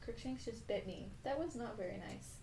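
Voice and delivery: very soft, off to the side; hushed little complaint; quiet aside. Real, not acted. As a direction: murmur a mild scolding to a pet. Aside after being bitten by cat.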